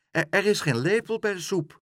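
put-on high girl's voice tripping on the first word; flustered, high-pitched stammer; stammering child. A narrator's reading. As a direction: stumble into a hasty excuse. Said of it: "stamelde Aagje".

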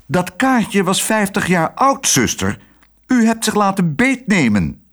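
high, indignant reproach; cross boss rebuking staff; stern scolding. A narrator's reading. Director scolds the tricked nurse.

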